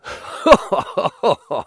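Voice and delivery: high bursting laugh; hearty laugh. A narrator's reading. Pirate laughing at Watson's sea raid joke, hearty laugh. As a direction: let a big laugh roll out. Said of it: "the pirate burst out in laughter".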